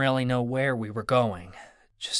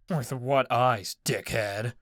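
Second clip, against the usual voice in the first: mocking thug; slower than usual. A narrator's reading.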